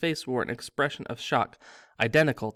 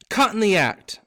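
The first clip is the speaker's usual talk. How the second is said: high, loud accusing yell; boy accusing Harry in the crowd; yelled accusation; 8 semitones higher, 8 dB louder than usual. A narrator's reading. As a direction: shout it, pointing at him. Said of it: "Ernie yelled".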